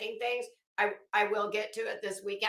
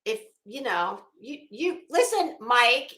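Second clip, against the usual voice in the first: ordinary delivery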